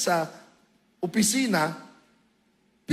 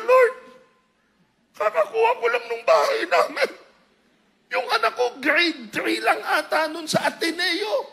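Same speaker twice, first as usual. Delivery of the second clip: much higher, louder, laughing comic lament; mock wailing; 11 semitones higher, 5 dB louder than usual. Praying to the Lord about the house, a comic wail. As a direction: cry out to the Lord in hammed-up panic.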